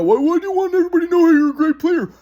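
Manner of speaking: high-pitched, loud mocking whine; mock grandpa. Grandson mimicking grandpa questioning him, high mocking whine. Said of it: "my grandpa wants to know".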